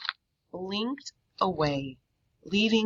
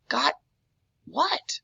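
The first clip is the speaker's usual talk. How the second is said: baffled question; 10 semitones higher than usual. A narrator's reading. Boy baffled by the stranger.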